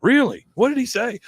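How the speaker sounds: high, eager mock questions; acted excitement. Recapper plays dad thrilled his son got yelled at, excitedly. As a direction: pitch leaping up, thrilled for the news. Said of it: "he's excited that, that the coach yelled".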